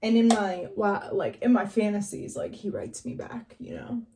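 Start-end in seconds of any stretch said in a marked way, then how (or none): 2.4-4.0 hushed, secretive whisper; breathy confiding whisper; whispered aside. Real, not acted.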